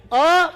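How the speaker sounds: अ said loud and open; bright open अ. Shown as a demonstration. Teacher builds Om from its parts, loud open अ. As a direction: call out अ as the start of Om. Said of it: "तीनों मिलाकर के".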